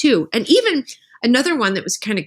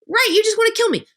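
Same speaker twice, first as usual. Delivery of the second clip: pitched up high, playful; high mock paraphrase; 12 semitones higher, faster than usual. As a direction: lift the voice high, teasing. Negotiator paraphrasing the suspect.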